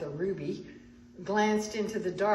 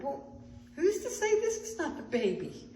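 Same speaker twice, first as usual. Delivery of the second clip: musing aloud in a higher pitch; wondering; 7 semitones higher than usual. A narrator's reading. Artaban looks at the baby, wondering. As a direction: lift the voice as if struck by a question. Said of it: "he wondered".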